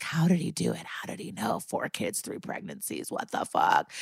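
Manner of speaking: whispered, baffled questions; whispered disbelief. Retold or imitated. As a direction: hush it like watching through glass. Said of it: "on the other side of the spyglass being like".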